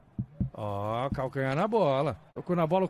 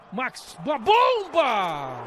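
excited shout; 7 semitones higher, 4 dB louder, much slower than usual. Real, not acted. Powerful shot.

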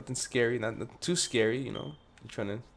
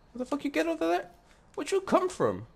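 much higher, squeaky shock; squeaky startled voice; 4 dB louder than usual. Real, not acted. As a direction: yelp it out in a high squeak. Startled by game character appearing.

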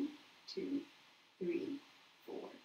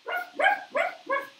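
a very high, loud playful puppy yip; high, yappy pretend barking; playful dog bark; 8 dB louder than usual. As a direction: bark like a silly little dog for the kids. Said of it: "what does the dog say".

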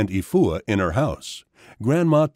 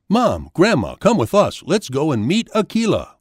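put-on higher, urgent child's call; eager scream; 6 semitones higher, 3 dB louder than usual. A narrator's reading. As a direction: call the family along, a mild storybook yell. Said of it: "Kofi screamed".

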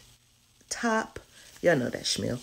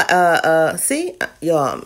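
ordinary delivery

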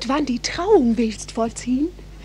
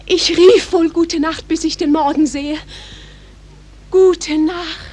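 louder, higher call; calling good night; 3 semitones higher, 9 dB louder than usual. Acted. Juliet calling good night after Romeo, loud. Parting calls across the garden.

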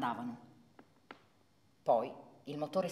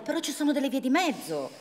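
pleading; 9 semitones higher, 7 dB louder, faster than usual. Acted. Parent bargaining with daughter.

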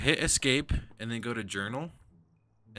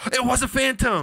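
high, loud triumphant shout; elated; 13 semitones higher, 9 dB louder than usual. Real, not acted. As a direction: shout it in amazed delight. They guessed the ghost right.

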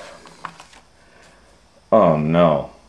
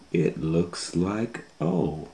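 plain reading voice

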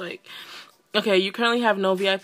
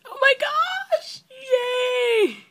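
very high, drawn-out squeal of joy; overjoyed squeal; 5 dB louder, much slower than usual. Real, not acted. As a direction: squeal it sky-high with delight. Overjoyed at baby update news.

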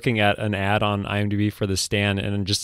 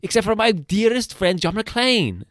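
put-on very high-pitched European-accented boasting; hammed-up, proud European director; 9 semitones higher than usual. Director crediting his dearest friend, European accent. Co-host takes up the European impression.

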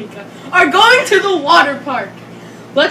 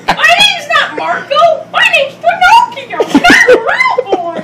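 much higher laughing yell; indignant toy voice; 11 semitones higher, 4 dB louder than usual. Pretend. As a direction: squeal it as Pinocchio, giggling. Toy protests its name, laughing.

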